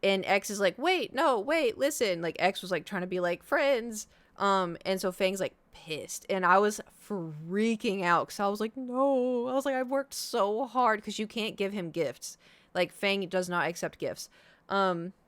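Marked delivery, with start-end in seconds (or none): 0.8-2.2 high pleading. Retold or imitated. X pleads as Fang leaves. 8.8-11.0 mock despair. Speaker retells own panic.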